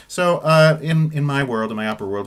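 usual speaking voice

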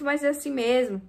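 ordinary talk

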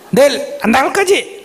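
a high, pressing mock question; husband's demand. Wife imitates her husband checking on tomorrow, high. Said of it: "네".

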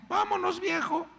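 high-pitched wife saying let's go. Retold or imitated. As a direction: play the wife agreeing right away. Wife voiced in retelling.

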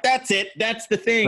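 light up on finding it; mild mock eureka. Imagined moment of recognition.

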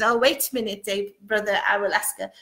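ordinary talk, nothing marked

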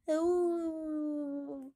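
high, long, sad howl; drawn-out pitiful "awoo"; dog howl. Acted. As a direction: answer with a mournful hound's howl. Dog answers by howling.

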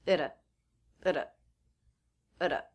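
"Ita" with a light flapped T; reduced at, flap T. Shown as a demonstration. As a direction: tap the T like a quick d. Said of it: "So the ending T will be a flap T".

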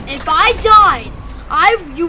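loud pretend rage at what could have been; mock mad outburst. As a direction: start a hammed-up rant, loudly. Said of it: "that's how mad I would have been".

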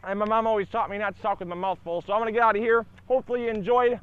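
excited. Real, not acted. Energetic channel sign-off.

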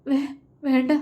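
halting, fearful plea; frightened stammer. A narrator's reading. Beaten man begging with folded hands, frightened stammer. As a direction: stumble on the first syllable as you beg.

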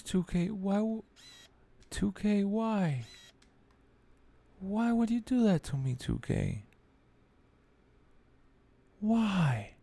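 high, plaintive pleading; pained lament. Real, not acted. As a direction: plead "why" like a heartbroken fan. Lamenting the loss to the game.

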